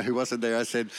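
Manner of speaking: ordinary delivery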